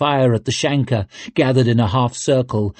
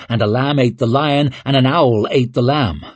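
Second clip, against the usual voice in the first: plain reading voice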